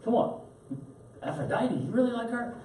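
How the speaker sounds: high-pitched, wheedling sales pitch for gods; cajoling Greek guys. Retold or imitated. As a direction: tease like a pushy pagan, voice way up. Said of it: "the Greek guys saying".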